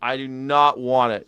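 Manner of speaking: ordinary talk, nothing marked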